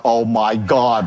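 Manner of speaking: slow, low, weary disbelief; retold exasperated groan of words. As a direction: drag it out as if you can't take another question. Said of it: "I'm so pissed".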